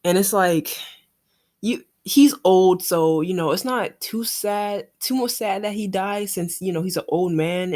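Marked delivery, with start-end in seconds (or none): none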